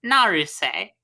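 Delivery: Naruse said nah-roo-seh, even Japanese vowels; correct name reading. Shown as a demonstration. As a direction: Naruse with flat even syllables, final seh. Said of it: "an actual read on that name".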